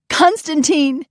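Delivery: very high, loud call; relieved cry. Acted. Mother running to her son, relieved.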